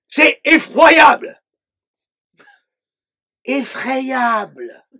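loud slow exasperated cry of annoyance; exasperated outburst. Acted. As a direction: throw the words out in fed-up fury. Outburst against the dictator voice.

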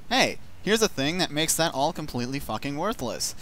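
ordinary talk, nothing marked

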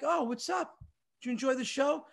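high, chirpy mock greeting; mock-sweet greeting. Comedian greets old teachers at his show, mock-friendly.

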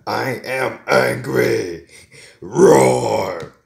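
loud declaration bursting into a roar; hammed-up angry roaring; play-acted anger. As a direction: announce the anger at full volume, then roar. Prickle acting out anger, roaring.

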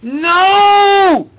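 long, very high shriek of defeat; anguished scream. Real, not acted. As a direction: scream it out in frustration. Loses the game again.

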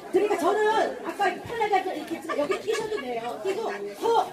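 ordinary talk

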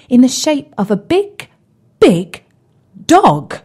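dramatic storyteller build to the reveal; building emphasis. A narrator's reading. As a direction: pump up big, big dog. The dog revealed, repeated big.

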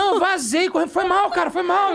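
high, loud, hurried-away apology; panicked apology. Retold or imitated. Comedian fleeing the luggage shop, panicked apology. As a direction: re-enact a mortified getaway.

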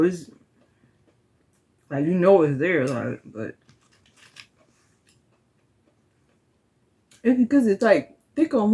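muffled behind hand; talking while chewing cookie; mouth full, hand over mouth. Real, not acted. Seen 1: Hand covers mouth throughout during a cookie tasting, likely still chewing.

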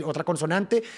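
usual speaking voice

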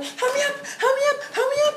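very high urgent squeal; frantic plea. Retold or imitated. Fan stuck sitting in the grass, frantic plea. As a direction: re-enact a panicky, high-pitched plea.

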